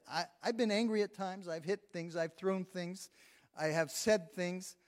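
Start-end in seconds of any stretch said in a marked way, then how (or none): none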